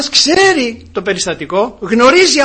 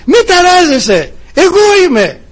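proclaiming; 6 semitones higher, 5 dB louder than usual. Retold or imitated. Christ's voice after trial.

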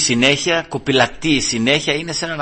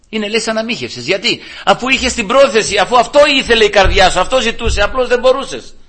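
louder, higher, with rising fervour; fervent preaching; 8 semitones higher, 4 dB louder than usual. Acted. Preacher to congregation, loud fervent preaching. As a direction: drive the point home to the congregation.

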